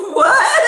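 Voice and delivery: high, loud squealing burst of laughter; shrieking laugh. Real, not acted. Teller cracking up at her friend, shrieking laughter. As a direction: let out a shrieking cackle.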